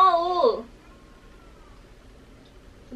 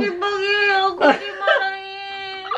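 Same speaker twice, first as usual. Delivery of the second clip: tearful, raised complaint; crying; 6 semitones higher than usual. Real, not acted. Wife scolded all day by prank, crying. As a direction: sob out the complaint. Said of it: "sampai nangis ini beneran".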